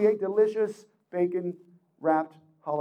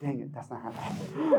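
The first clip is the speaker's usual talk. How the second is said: muttered inner thought; 7 semitones lower, 11 dB quieter than usual. Retold or imitated. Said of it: "what they're also saying, thinking".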